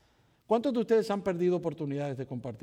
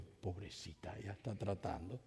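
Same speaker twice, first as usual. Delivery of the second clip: much softer, tender aside; tender pity; 11 semitones lower, 14 dB quieter than usual. Retold or imitated. Pastor moved by the young agent, soft pity. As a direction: murmur it with sudden pity.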